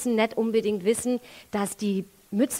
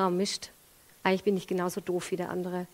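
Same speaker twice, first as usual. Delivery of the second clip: muttered self-reproach; 5 semitones lower, 9 dB quieter, faster than usual. Retold or imitated. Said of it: "merkt ihr".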